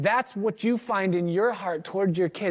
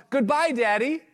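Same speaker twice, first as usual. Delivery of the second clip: put-on child calling goodbye; 9 semitones higher than usual. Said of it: "you turned and waved a hand and called".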